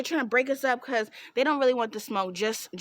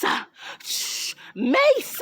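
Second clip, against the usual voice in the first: spray hiss then a shouted high mace; mace spray sound effect; 3 dB louder, much slower than usual. Retold or imitated. Friend hears her mimic the mace attack, shouting. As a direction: act out the spray, then yell the word.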